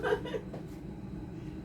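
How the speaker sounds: short high wheeze; breathless laugh. Real, not acted. Cook stinging from onions, airless giggle. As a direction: giggle without voice.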